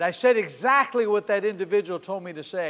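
ordinary talk, nothing marked

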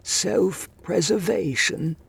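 high, slow disbelieving echo; incredulous. A narrator's reading. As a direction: repeat it in haughty disbelief. Master echoes in disbelief.

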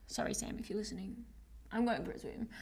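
soft guilty aside to partner; quiet aside. Real, not acted. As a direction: murmur a sheepish apology.

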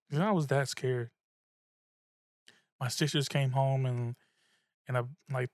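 ordinary talk

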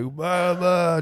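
put-on slurred high drunk blah blah; drunk babble. Imitating the wasted stranger at the door, slurring. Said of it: "he's just like".

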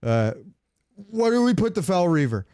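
ordinary delivery

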